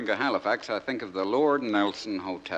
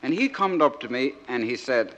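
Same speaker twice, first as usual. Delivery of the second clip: naive outport soldier son reading his letter; broad dialect, lively comic recitation; George from Crinkle Cove; 7 semitones higher, 4 dB louder than usual. Acted. Soldier son writing home to Mama and Dadda, naive and earnest.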